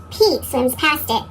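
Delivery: ordinary delivery, nothing marked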